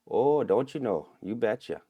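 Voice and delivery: a hint of northern Minnesota folksy accent. Imitating how people in northern Minnesota talk, light Minnesota accent. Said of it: "people don't really talk like that".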